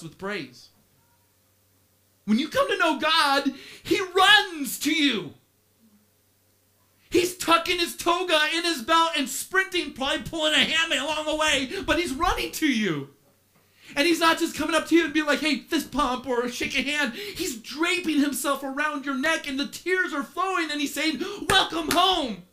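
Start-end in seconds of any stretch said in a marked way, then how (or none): 2.2-21.2 high, loud, impassioned preaching; fiery sermon climax, voice lifted high; preaching at full force. Acted. Preaching climax on the Father's love. 21.5-22.4 high, loud cry of welcome; joyful father calling out; loving father's welcome. Acted. Father welcomes the son home.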